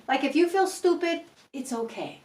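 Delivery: ordinary talk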